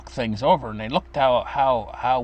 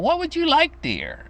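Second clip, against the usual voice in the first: put-on sweet old grandma. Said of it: "and she'd say".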